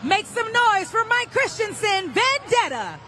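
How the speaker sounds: dueling hype announcers. Real, not acted. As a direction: pump up the stadium crowd.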